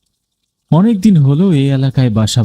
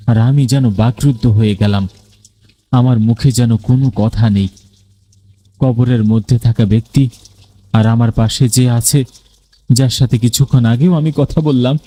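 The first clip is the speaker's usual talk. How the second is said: plain reading voice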